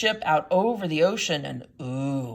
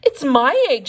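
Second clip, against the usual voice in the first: loud, higher indignant sputter; 6 semitones higher, 9 dB louder than usual. A narrator's reading. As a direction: spit it out in outrage. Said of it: "she sputtered".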